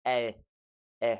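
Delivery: "E, e" as the vowel in egg, then in bit; separate vowel sounds. Shown as a demonstration. Teacher splitting the diphthong, "E, e" as eh and ih. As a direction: say "E, e" as eh, then ih, apart. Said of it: "the E in egg and the I in bit".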